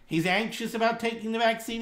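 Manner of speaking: ordinary talk, nothing marked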